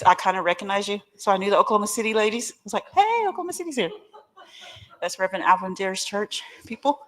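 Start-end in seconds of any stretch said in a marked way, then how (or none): 2.9-3.9 high bright call of recognition; excited greeting. Retold or imitated. Greeting the Oklahoma City ladies, a high excited call. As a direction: call out happily across the room.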